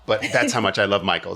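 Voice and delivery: normal talking voice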